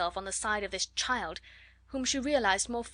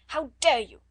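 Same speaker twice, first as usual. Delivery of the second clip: much louder, higher, shouted "How dare you"; furious shout; 5 semitones higher, 9 dB louder than usual. A narrator's reading. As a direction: let the anger explode. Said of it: "she cried out".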